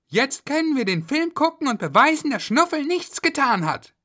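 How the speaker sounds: put-on high eager kid's voice; a kid sure of the proof; excited child. Kids plan to prove Schnuffel innocent, high and eager.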